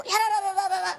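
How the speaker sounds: high mocking da-da-da of his rant; mocking blah-blah. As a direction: squeak out his rant as nonsense.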